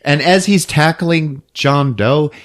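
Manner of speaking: usual speaking voice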